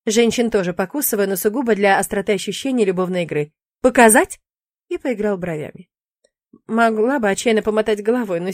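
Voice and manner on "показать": loud, playful tease; teasing offer. Acted. Demon teasing the captive witch, loud and playful. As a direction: dangle it like a flirt.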